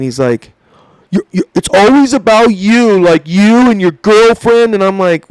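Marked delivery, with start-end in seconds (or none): 1.1-4.7 high, loud imitation of a griping trainer; mock whiny complaint. Jealous trainer griping to his trainee, mock aggrieved. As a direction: stutter into it, then drag out the accusation.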